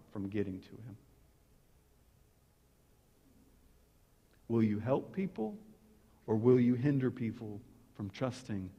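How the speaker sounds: low, soft, earnest appeal; intimate preaching. Real, not acted. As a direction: lean in and ask it gently. Quiet intimate appeal to congregation.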